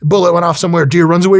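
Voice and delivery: ordinary delivery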